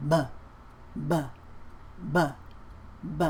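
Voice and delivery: "B" with throat vibration; voiced B. Shown as a demonstration. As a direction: voice the "B" firmly. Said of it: "B is voiced".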